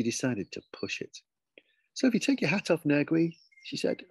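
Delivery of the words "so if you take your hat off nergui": high, sing-out teacherly instruction; coaxing teacher. Acted. Teacher pushing the new boy to remove his hat, high coaxing. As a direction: lift the pitch and make the order sound easy. Teacher pushes her advantage.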